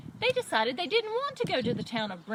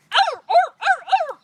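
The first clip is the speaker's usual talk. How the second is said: loud, high-pitched sharp barks; dog barking; 12 dB louder than usual. Acted. Dog attacking robber in dark, high loud barking. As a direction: bark like a startled dog.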